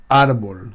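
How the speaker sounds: árbol stressed on its first syllable. Shown as a demonstration. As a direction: hit the "ár" of árbol. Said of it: "il y a un accent sur le A de árbol".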